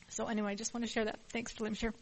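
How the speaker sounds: ordinary talk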